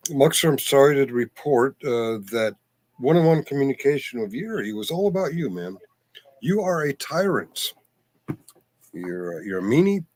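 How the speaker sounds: loud, hammed-up telling-off in jest; mock accusation. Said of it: "I'm kidding".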